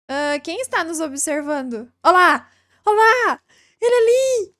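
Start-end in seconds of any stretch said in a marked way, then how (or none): 2.0-4.5 high, loud call to someone unseen; nervous loud calling out. Real, not acted. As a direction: shout olá at the window. Calling out to a watcher.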